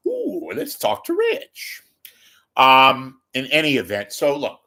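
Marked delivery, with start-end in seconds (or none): 0.1-1.8 high, slow cooing fan voice; mock gushing fan. Comic mocking a fawning YouTube crowd, high coo. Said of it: "kind of a".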